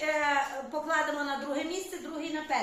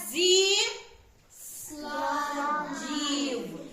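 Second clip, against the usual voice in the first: СКЛАДІВ drawn out syllable by syllable; ЗІ held long, pause, СКЛАДІВ spelled out slowly; emphatic stretched stress; much slower than usual. Shown as a demonstration. Emphasizing the task's wording.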